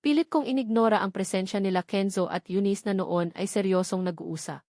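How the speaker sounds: ordinary delivery, nothing marked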